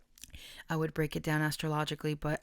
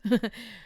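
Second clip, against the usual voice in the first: tearful laugh; 3 semitones higher, 6 dB louder than usual. Real, not acted. Laughing at own tears.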